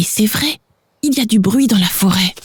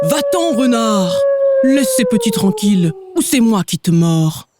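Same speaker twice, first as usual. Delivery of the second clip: mild growl; 7 semitones higher than usual. Acted. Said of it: "Il gronde".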